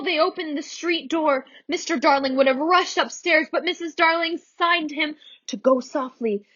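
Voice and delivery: usual speaking voice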